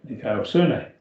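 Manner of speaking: Dikaiosune at a quick, joined-up pace; sped up. Shown as a demonstration. Teacher showing the class the finished Greek word, sped up. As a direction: run the syllables of Dikaiosune together swiftly. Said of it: "then speed yourself up and you'll get it".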